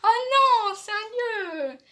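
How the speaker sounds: high, loud, startled "oh no"; surprised mother. Retold or imitated. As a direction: mimic mom's shocked dismay. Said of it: "elle a dit".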